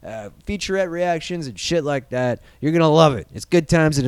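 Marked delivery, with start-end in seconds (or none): none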